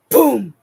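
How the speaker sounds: loud, punchy boom; imitated boom. Client shows trainer what happened, loud imitated boom. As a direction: burst it out like a small explosion. Imitating sudden upward movement.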